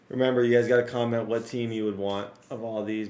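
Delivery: normal talking voice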